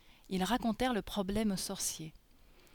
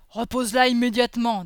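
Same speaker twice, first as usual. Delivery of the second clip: loud, forceful demand; booming character voice, outraged; angry ogre command; 2 semitones higher, 10 dB louder than usual. Acted. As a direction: thunder an order as the protective ogre. Boris confronts the other ogre.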